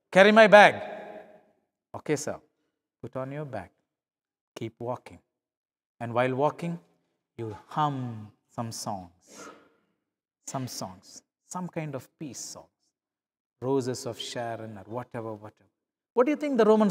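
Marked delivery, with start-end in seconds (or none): 0.1-0.7 louder curt command; curt order. Acted. Roman soldier ordering the farmer to carry his bag, loud and curt. 1.9-15.7 gentle calm. Acted. Second miler carrying the bag peacefully, soft and calm. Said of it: "with a gentle calmness of spirit".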